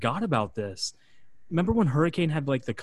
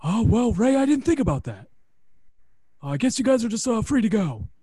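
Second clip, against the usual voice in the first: meek backpedaling boss; 10 semitones higher, 7 dB louder than usual. Retold or imitated. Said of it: "Johnny Ace goes".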